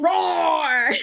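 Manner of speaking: silly high, loud roar; lion roar. Shown as a demonstration. Mom showing kids a silly lion breath, playful roar. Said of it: "breathe out like a lion".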